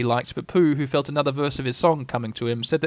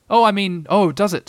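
high, quick squeaky voice; flustered Piglet; 6 semitones higher, 6 dB louder than usual. A narrator's reading. Caught out by Eeyore, a high flustered Piglet. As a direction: blurt it out, flustered little pig. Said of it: "he explained quickly".

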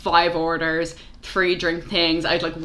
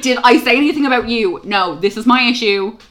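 louder, higher exasperated comeback; indignant retort; 5 semitones higher, 7 dB louder than usual. Retold or imitated. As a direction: push back at an accusing customer. Acting out quarrel with customer.

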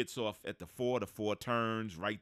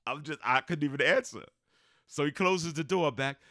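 ordinary talk, nothing marked

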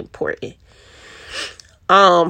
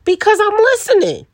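much higher and louder, exasperated protest; exasperated high burst; 5 dB louder than usual. Acted. Mom to kids who doubt her, exasperated high protest. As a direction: insist you were listening all along, voice jumping up. Answering her kids' complaint.

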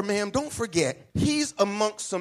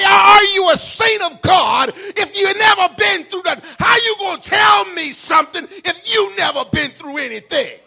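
much higher, loud challenging shout; full-force preaching; 13 semitones higher, 13 dB louder than usual. Acted. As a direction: hurl the question at the church.

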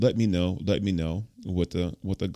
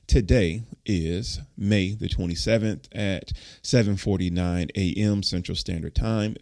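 ordinary talk, nothing marked

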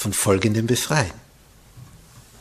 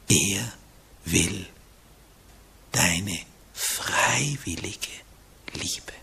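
low, soft and slow; tender whispered plea about love; hushed sincere; 5 semitones lower, 8 dB quieter, much slower than usual. Real, not acted. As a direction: lean in and almost whisper it. Intimate climax of sermon.

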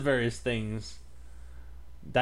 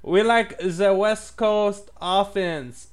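high, slow, far-off put-on Russian; fake Russian accent; 8 semitones higher, 4 dB louder, much slower than usual. Caller bragging about football in a slow Russian accent. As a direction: drawl it like a fake Russian fan. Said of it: "I don't think that is Russian".

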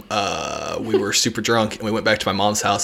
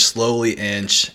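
slowly inch drawn out slow; slow creep; 4 dB quieter, much slower than usual. Shown as a demonstration. Creeping toward the cat, said slowly. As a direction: stretch it out as if creeping.

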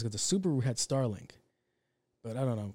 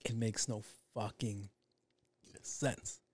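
hushed verdict; 2 semitones lower, 9 dB quieter, slower than usual. Real, not acted. His flat verdict on the idea.

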